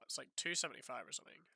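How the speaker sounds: ordinary talk, nothing marked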